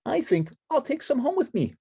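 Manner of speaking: high, bright-eyed puppet chatter; Ernie voice. Acted. Ernie showing friends the autumn woods, high and eager.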